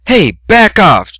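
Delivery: loud high slow shout. Acted. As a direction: yell at the rival. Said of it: "Twilight shouted".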